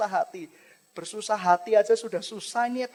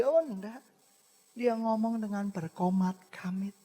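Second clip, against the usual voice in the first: ordinary talk, nothing marked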